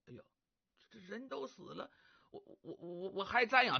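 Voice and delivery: 声音缩得很低，连连打结巴; 像被吓着了，不敢大声说; 害怕地小声嘀咕. Acted. 李玄害怕瞻仰死人. (李玄听说要看死人遗容，吓得小声结巴)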